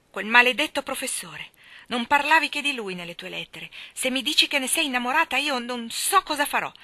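loud, seething jealous rant; jealous fury. A narrator's reading. Laurie raging at Jo over the professor: loud jealous fury. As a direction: curse the rival with clenched fists. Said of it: "stringeva i pugni con un lampo di fuoco".

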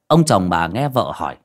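ordinary delivery

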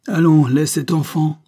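loud, high commanding intervention; strong voice. A narrator's reading. Stranger stops the beating, strong commanding voice. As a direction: step in forcefully. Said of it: "dit une voix forte".

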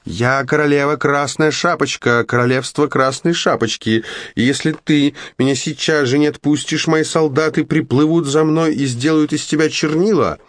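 high, grand royal threat; haughty queen voice. Acted. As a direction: play a pompous little queen. Girl playing a haughty queen.

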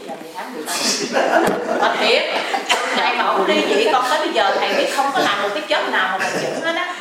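laughing. Real, not acted. Woman telling the master about jobs she lost, laughing.

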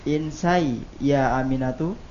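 "Insai ya Aminatu" ending on a rise; question intonation. Real, not acted. Student repeating at the teacher's request, question intonation. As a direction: end "Aminatu" upward, as if asking. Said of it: "kembali ke intonasi pertanyaan".

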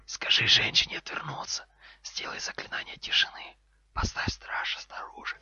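terse snake whisper, fading at the end; abrupt hissed commands. Acted. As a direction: hiss orders one by one. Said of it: "а затем отрывистые команды".

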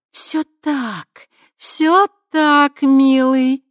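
high, loud, falsely soothing; femme fatale. A narrator's reading. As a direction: soothe him with false sweetness. Krista soothing.

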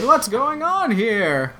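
high, drawn-out mock outburst; demanding boss. CEO comes out to the commotion, mock-indignant and high. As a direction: imitate an indignant boss, stretching the words.

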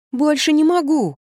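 high, slow, stretched-out plea; plaintive refusal. A narrator's reading. Daughter forced to eat by her mother, plaintive. Daughter pleading she is full.

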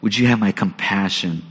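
soft, low, searching question; quiet, tender appeal from God to Jonah. Retold or imitated. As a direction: ask gently, almost under the breath. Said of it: "God says".

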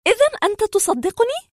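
high-pitched woman's eager question; hopeful woman patient. Acted. Patient asks the doctor if he believes her, high and eager. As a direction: ask it high, hoping to be believed.

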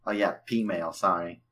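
ordinary talk, nothing marked

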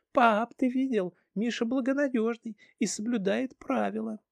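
put-on high child's voice; excited little girl. Daughter talks to dad.

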